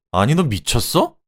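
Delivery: shouting husband. A narrator's reading. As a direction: shout back in disbelief. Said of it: "라며 소리쳤죠". (남편이 어이없어하며 소리치듯)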